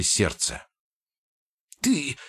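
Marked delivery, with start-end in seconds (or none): 1.8-2.2 high, slow, breathless gnome threat; gasping wheeze. Acted. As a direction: force it out while winded. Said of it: "задыхаясь, прохрипел Гизмо".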